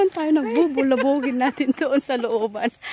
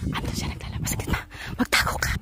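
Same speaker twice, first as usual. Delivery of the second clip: urgent hushed whisper; whispering while hiding; 8 dB quieter than usual. Real, not acted. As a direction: whisper quickly, staying hidden. Spotting target, telling others hide. (pabulong habang nagtatago)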